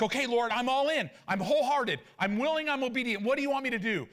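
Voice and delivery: retold eager pledge, high and insistent; eager surrender. Telling the Lord he's all in, eager and high-pitched. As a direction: pitch it up, pledging yourself wholeheartedly.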